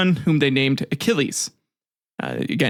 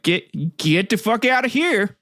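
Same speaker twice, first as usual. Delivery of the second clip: higher-pitched, mock dismissive order; mock banishing; 6 semitones higher, slower than usual. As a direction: send him packing in a joking tone. Acts out father banishing baby Paris.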